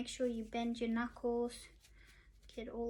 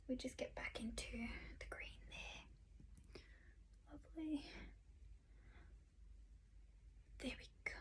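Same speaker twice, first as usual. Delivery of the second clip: soft unvoiced whisper; 19 dB quieter than usual. Real, not acted. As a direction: whisper it close to the mic. Whispered makeup tutorial.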